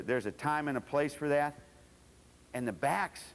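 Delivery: normal talking voice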